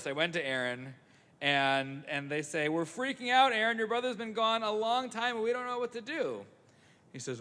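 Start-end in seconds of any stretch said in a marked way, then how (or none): 2.7-6.4 higher, anxious crowd; mock panic. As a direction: rush it out like frightened people. Israelites panic to Aaron.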